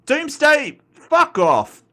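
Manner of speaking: high, loud scoffing dismissal; indignant outburst. Real, not acted. Host rejecting a viewer's comic-book answer, indignant. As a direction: burst out in disbelief, pitched high.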